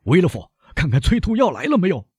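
urgent call. A narrator's reading. Doctor urgently calls for emetic.

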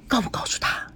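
hushed whisper between grandparents. A narrator's reading. Grandparents conferring secretly, whispered. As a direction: breathe it so the grandson can't hear. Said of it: "小声地问".

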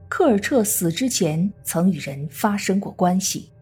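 usual speaking voice

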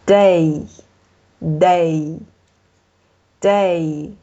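"day" stretched very slowly, said three times; drawn-out repeated demo. Shown as a demonstration. 老师给学生放慢示范读"day". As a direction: draw out each "day" for students to hear. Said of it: "你应该能听出来它们的区别".

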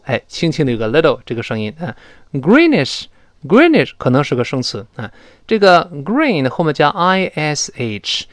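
usual speaking voice

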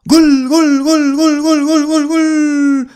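high, loud, rapid-fire goal calls; breakneck goal commentary. Shown as a demonstration. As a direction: shout goal again and again like a commentator. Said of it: "或者". (激动的进球解说)

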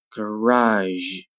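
"Garage" with long vowel and final zh; slow careful "Garage"; drawn-out model. Shown as a demonstration. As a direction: stretch "Garage" out for learners. Teacher models word slowly, then normally.

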